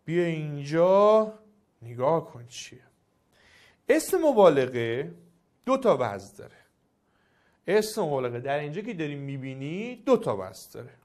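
ordinary delivery

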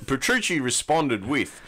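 ordinary talk

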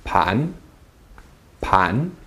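"Pan" rising from low; questioning upward "Pan"; rising second tone. Shown as a demonstration. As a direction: lift the pitch through each "Pan".

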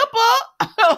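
bright burst of high laughter. Real, not acted. Recapping her soap, laughing at her own joke.

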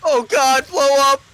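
high, loud frantic yell; panicked scream. Real, not acted. Panic in combat.

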